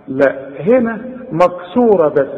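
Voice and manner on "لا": ordinary talk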